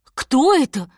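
mild alarm from mother bear; frightened. A narrator's reading. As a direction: jump back with a startled question. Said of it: "испуганно спросила она".

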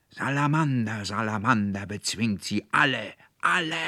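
loud, high roar of rage; furious bellow. A narrator's reading. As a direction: bellow it out, surging on the last word. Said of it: "brüllte der Konrektor Paulmann in höchster Wut".

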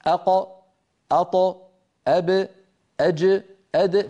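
أق, أط, أب, أج, أد each a quick sharp bounce; short crisp qalqala. Shown as a demonstration. Teacher drilling the letters with short qalqala. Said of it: "أقصر ما تكون".

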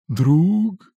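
high, loud divine question; echoing ethereal energy being. A narrator's reading. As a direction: ring out high and sharp. Goddess asks in dream.

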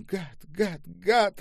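high cries, the last loudest; sobbing. A narrator's reading. Olga curses her tormentor, sobbing cry. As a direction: spit out each word through tears. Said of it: "рыдала Ольга".

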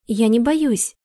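blurted out firmly. A narrator's reading. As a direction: blurt it, resolute. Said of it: "выпалила она твёрдо". (твёрдо, выпалив)

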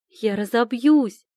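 loud, slow panicked cry while falling; I'm going to crash, loud and scared; frightened cry. Acted. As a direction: shout it out in fear of crashing. Falling from the sky, fear.

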